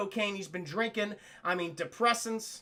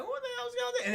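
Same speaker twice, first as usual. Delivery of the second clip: much higher, startled question; surprised squeak; 14 semitones higher, 4 dB quieter than usual. Acted. Chad picking up an unexpected call, high startled squeak. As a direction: yelp it, caught off guard.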